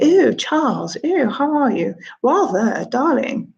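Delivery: put-on high, posh English Queen accent; mock the Queen in a posh English accent. Child mimicking the Queen of England, posh English accent. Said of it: "I'd put on a posh voice".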